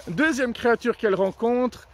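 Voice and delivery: ordinary talk, nothing marked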